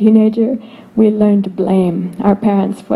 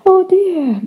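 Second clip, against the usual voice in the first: tender sympathy; 8 semitones higher, much slower than usual. Retold or imitated. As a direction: sigh it out with heartfelt sympathy. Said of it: "our hearts are like".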